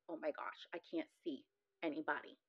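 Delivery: soft, slightly sinking voice; retold quiet dismay. Woman recalling losing her sight, quiet dismay. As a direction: say it softly, as if dazed.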